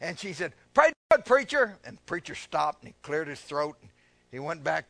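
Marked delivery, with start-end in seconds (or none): 0.7-1.8 high, loud exclamation, easing off; imitating excited churchgoer. Lady interrupting the preacher, mimicked excitement. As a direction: burst out in praise like an excited listener. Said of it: "she got excited over hearing the word".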